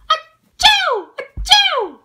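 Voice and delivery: wet sneezes. Acted. Sick character sneezing.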